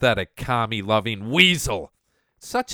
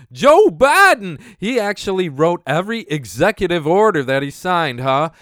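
mocking dumb believer; 6 semitones higher, 8 dB louder than usual. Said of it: "Like thinking".

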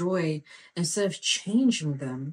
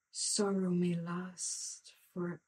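hushed slow scripture; 9 dB quieter, slower than usual. Real, not acted.

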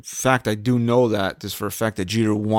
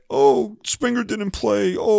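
high, slow whiny mock fan voice; 9 semitones higher, slower than usual. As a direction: mimic complaining rival fans. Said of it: "what I don't wanna hear from the media or Astros fans".

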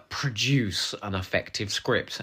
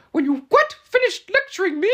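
very high, loud squeaky indignation; mock-indignant, strained high squeak; 9 dB louder than usual. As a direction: squeal it out in camp outrage. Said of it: "goes in that sort of squeaky voice".

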